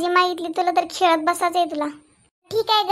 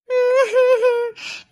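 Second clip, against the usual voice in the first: bitter laugh; 6 semitones higher than usual. Acted. Mother mock-laughs bitterly.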